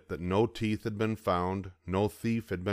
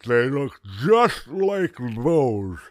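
put-on loud, slow gummy old man accusing; suspicious toothless grandpa; 5 semitones higher, 8 dB louder, much slower than usual. A narrator's reading. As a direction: drag it out suspiciously. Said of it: "growled suspiciously".

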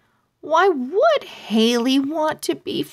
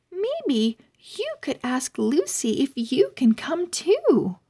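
put-on higher, apologetic girl's voice, a little quick; polite young owl girl; 3 semitones higher than usual. Haley apologising to Eva for the sleepover, politely awkward. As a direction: let her down gently, sorry and a bit rushed.

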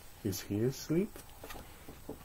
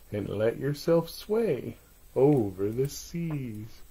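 bedtime rhyme; 3 semitones higher than usual. A narrator's reading. Soothing bedtime rhyme read aloud.

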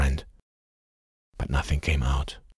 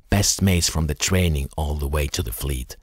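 ordinary delivery

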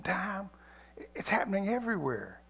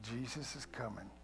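much softer, hushed appeal; soft altar call; 5 semitones lower, 12 dB quieter than usual. Acted. Pastor inviting the standing church, hushed. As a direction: draw them in quietly.

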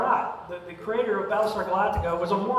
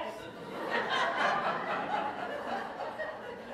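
breathy real laugh; 8 dB quieter than usual. Real, not acted. Laughing at own impression.

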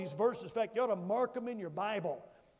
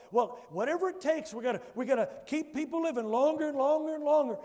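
mock urgency; 5 semitones higher, 7 dB louder than usual. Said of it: "mocking the modern attitude".

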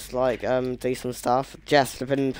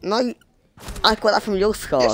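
raised bickering; 8 semitones higher than usual. Real, not acted.

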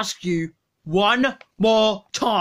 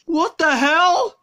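shocked outburst; 5 semitones higher than usual. Acted. Donut missing, shocked outburst.

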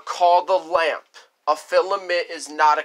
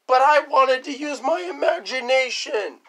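put-on high, pleading child protest; childish backtalk; whiny kid voice; 7 semitones higher than usual. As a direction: whine it like a scolded kid. Kid answering back to parent.

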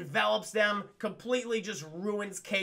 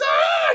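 loud, high mock shriek; imitated scream; 14 dB louder than usual. Acting out Sylvester losing his tail, screaming. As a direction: let out a sudden cartoon scream. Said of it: "He's screaming".

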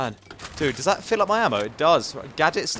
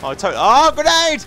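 sudden high alarmed shout; grenade warning yell; 10 semitones higher, 5 dB louder than usual. Real, not acted. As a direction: yell out the danger. Grenade lands near player.

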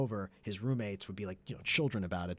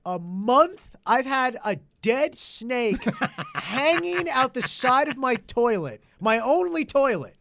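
higher, louder, slow and laughing through it; mock incredulity, cracking up; laughing disbelief; 12 semitones higher, 9 dB louder, slower than usual. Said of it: "And I'm like".

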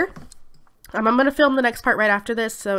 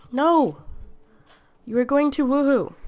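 ordinary delivery

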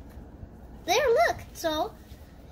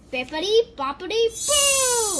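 magic spell; 6 semitones higher, 6 dB louder, slower than usual. Pretend. Magic spell in doll play.

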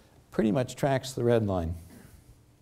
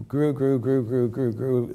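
GREW repeated in an even, raised chant-like run; rhythmic repetition; 3 semitones higher, slower than usual. Shown as a demonstration. Lecturer tracing women's rising participation, each GREW evenly repeated. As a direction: hit each GREW evenly, higher, like steps climbing. Mimicking steady growth by repetition.

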